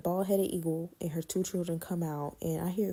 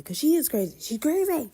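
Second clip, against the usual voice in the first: exasperated; 10 semitones higher, 7 dB louder than usual. Real, not acted. Emphatic exclamation.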